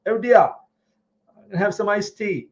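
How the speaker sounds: high-pitched, hammed-up British accent; mock British accent. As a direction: tease with a put-on British voice. Mimics British viewer from Bristol.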